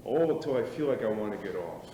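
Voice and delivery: ordinary delivery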